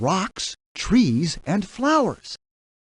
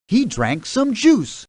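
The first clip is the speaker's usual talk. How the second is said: ordinary delivery, nothing marked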